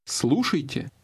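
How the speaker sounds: ordinary talk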